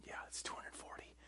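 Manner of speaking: whispered correction off mic; hushed, breathy aside to a helper. Real, not acted. A preacher whispering a correction to his helper.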